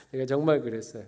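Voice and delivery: usual speaking voice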